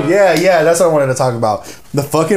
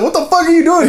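very high-pitched incredulous question; amazed. Retold or imitated. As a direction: react in playful disbelief to an old friend. Retold amazement at friend's new job.

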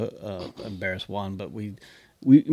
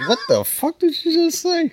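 very high, mock-bewildered outburst; hammed-up confusion, louder and far higher; baffled reaction; 5 dB louder than usual. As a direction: squeak it in exaggerated disbelief. Acting out baffled reaction to meme.

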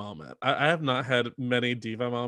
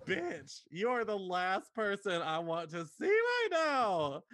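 contemptuous Ivy; 7 semitones higher, 8 dB quieter, slower than usual. Retold or imitated. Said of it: "start being like".

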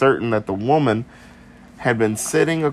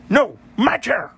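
put-on weird British accent; 12 semitones higher than usual. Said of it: "so we're going with weird British grunts".

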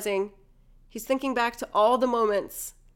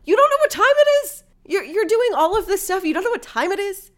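high, loud disbelieving questions; mock incredulity; 10 semitones higher, 7 dB louder than usual. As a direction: challenge him in exaggerated disbelief.

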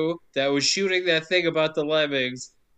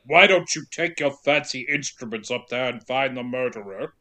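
lower, stern British actor impression; Christopher Lee; 5 semitones lower than usual. Podcaster as the judge dismissing the detective, imperious impression. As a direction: imitate a haughty judge giving orders. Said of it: "And Lee's like".

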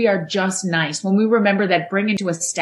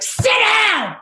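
sharp high yelled command; strict teacher shout; 12 semitones higher, 3 dB louder than usual. Retold or imitated. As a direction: bark it like a harsh teacher. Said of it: "teachers who are like".

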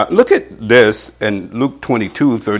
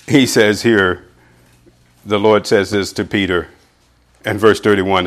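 ordinary talk, nothing marked

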